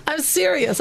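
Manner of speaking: high bubbling giggle into the words; a real giggle, still half laughing. Real, not acted. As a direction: laugh at yourself, then insist you mean it. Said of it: "I'm laughing, but I'm very serious".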